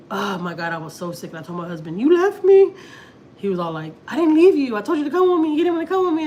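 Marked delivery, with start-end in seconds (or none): 2.0-2.7 high, loud wail of reproach; imitated upset outburst, pitched way up; hurt accusation. Retold reproach to her husband. 4.1-6.2 high, loud arguing back; imitated indignant comeback, pitched up; defensive protest. Retold husband defending himself, quarrel.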